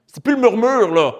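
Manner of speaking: normal talking voice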